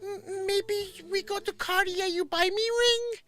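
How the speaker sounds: slow, wheedling falsetto; mock scammer woman. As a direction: imitate her coaxing, very high and drawn out. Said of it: "all of a sudden she goes".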